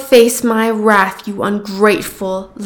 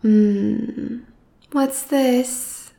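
ordinary talk

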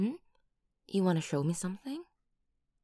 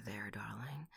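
soft whisper; 5 semitones lower, 12 dB quieter than usual. Acted. Comforting hug.

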